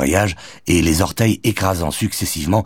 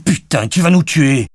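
high-pitched panicked reproach; frightened, angry exclamation; alarmed outburst; 10 semitones higher, 4 dB louder than usual. A narrator's reading. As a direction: cry out at a reckless driver. Panicked passenger after near accident.

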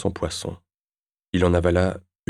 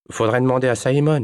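higher, louder deflection; evasive; 6 semitones higher, 5 dB louder than usual. A narrator's reading. Teenager dodging Sébastien's poker questions, evasive. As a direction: pass the question on to someone else. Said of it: "esquiva-t-il".

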